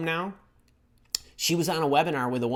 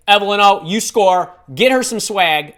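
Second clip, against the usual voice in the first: excited call-out; 4 semitones higher, 8 dB louder than usual. Real, not acted. Rewarding a viewer.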